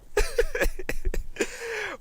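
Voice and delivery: high-pitched drawn-out laugh. Real, not acted. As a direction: burst into a long, high laugh. Host laughs at the accent denial.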